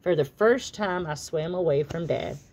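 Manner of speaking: plain reading voice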